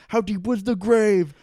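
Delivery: loud, high acted demand; explosive mock-interrogation yell; interrogator's outburst. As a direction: build to a shouted interrogation question. Acts interrogator freaking out at climax.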